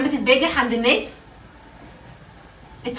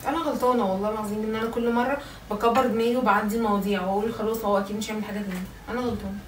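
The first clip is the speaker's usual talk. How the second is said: quiet hurt; 3 semitones lower, 6 dB quieter than usual. Real, not acted. Wife hurt after the argument.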